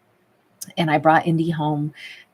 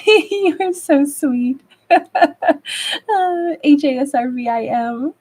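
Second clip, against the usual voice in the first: much higher, louder and slow, melting over the compliment; gushing delight; 9 semitones higher, 5 dB louder, much slower than usual. Real, not acted. Streamer gushing to a viewer who sent kind words, delighted. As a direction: swoon audibly at a sweet message.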